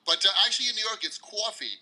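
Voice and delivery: "coffee" said kwaw-fee with a rounded New York aw. Shown as a demonstration. Guest shows New York pronunciation, kwaw-fee. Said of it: "interesting way of pronouncing some words".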